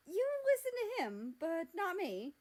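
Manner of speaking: high, loud petulant complaint; indignant whine. Acted. America whining loudly at Mexico and Canada. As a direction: whine it like a jealous little brother.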